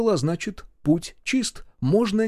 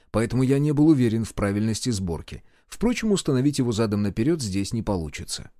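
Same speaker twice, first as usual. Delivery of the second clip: ordinary delivery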